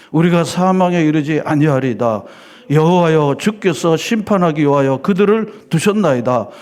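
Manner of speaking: formal Bible reading in a sermon; solemn scripture reading. Acted. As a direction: proclaim the verse to the whole church. Reads scripture aloud to congregation.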